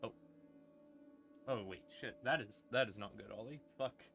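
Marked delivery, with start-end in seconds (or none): none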